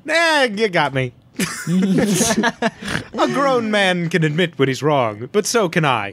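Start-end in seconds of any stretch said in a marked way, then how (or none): 1.7-5.3 slow, high grand pronouncement; mock grand. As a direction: declare it with mock dignity.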